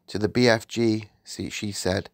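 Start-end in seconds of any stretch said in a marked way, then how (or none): none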